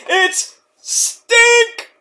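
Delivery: high, thrilled call-out; squealing entrance call; excited announcer. Retold or imitated. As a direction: hype the entrance.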